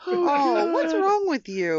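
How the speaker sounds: high, loud, drawn-out protest; appalled groan. Real, not acted. Co-host scolds the joker over tasteless joke, appalled. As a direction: wail it in disgusted disbelief.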